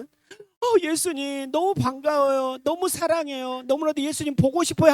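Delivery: high-pitched, gushing mock delight; overjoyed greeting. Imagined believer greeting Jesus, hammed-up joy. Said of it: "처음의 반응은 어때".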